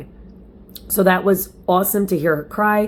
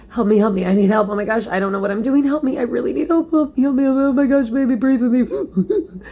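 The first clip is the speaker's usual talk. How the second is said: mock panic, climbing higher as it goes; imitating panicked pleading; 5 semitones higher than usual. Mom imitates herself begging husband for help, rising mock panic. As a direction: plead for help, rising in pitch toward the end. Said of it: "I'm basically doing my good old".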